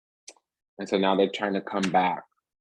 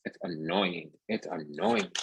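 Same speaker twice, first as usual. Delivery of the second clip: high, soft whine of annoyance; annoyed; 9 semitones higher, 6 dB quieter than usual. Real, not acted. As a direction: complain in a small, high-pitched voice. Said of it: "I'm annoyed".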